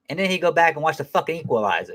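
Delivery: usual speaking voice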